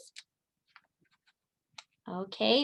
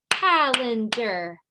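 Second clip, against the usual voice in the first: Calendar split into three slow beats, cal-en-dar; clapped syllables; much slower than usual. Shown as a demonstration. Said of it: "Let's clap it out".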